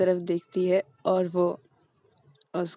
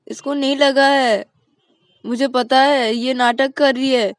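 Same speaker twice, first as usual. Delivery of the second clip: higher and louder, slowing, tattling to mom; accusing whine; 6 semitones higher, 9 dB louder than usual. Acted. Payal telling mom her sister is faking, loud high accusing. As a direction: insist loudly she's faking it.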